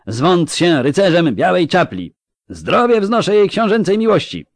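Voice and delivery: high, loud bombastic oath; zealous knight proclaiming at full voice; yelled knightly vow. Acted. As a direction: shout a pledge and toast across the banquet hall. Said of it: "wrzasnął drugi rycerz".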